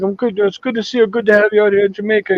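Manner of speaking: put-on high-pitched Jamaican accent; Jamaican guy. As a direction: welcome the tourist in a sunny Jamaican voice. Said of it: "this Jamaican guy".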